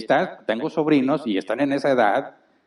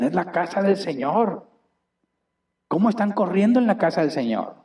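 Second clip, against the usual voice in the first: higher, indignant mock outrage; scandalized critics; 5 semitones higher than usual. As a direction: imitate shocked churchgoers scolding. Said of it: "Decían". (crítico escandalizado, tono más agudo e indignado)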